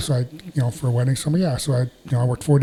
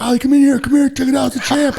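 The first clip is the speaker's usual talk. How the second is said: much higher, louder shout to the next room; excited fan call; 11 semitones higher, 4 dB louder than usual. Retold or imitated. Fan calling brother Ali to see the champ, excited high shout. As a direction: call out like you found a treasure.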